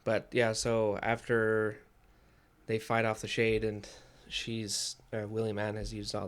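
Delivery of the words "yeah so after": ordinary delivery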